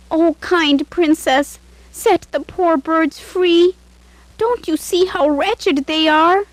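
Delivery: high, slow, begging voice; pleading maid. A narrator's reading. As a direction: beg the princess for mercy.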